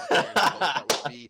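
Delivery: loud, laughing outburst; laughing shout. Real, not acted. Caster laughing loudly at her own bunny joke.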